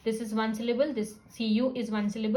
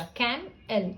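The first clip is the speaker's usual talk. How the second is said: कैम एल as two separate beats; two sound units; slower than usual. Shown as a demonstration. Teacher splitting camel, कैम एल in two distinct units. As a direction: break after कैम, then एल. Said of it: "दो sound units वाला".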